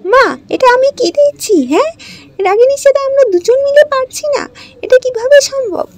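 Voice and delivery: high, loud, sneering stepsister voice; spiteful stepsister. Acted. Stepsister scolding Ragini over the dress, high and loud. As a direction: jeer at her, shrill and loud.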